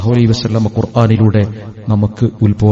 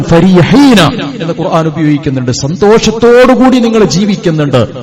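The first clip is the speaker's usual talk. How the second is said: high-pitched, ringing sermon delivery; elevated, emphatic preacher's voice; impassioned preaching; 6 semitones higher, 3 dB louder than usual. Real, not acted. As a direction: preach it out, riding high on each phrase.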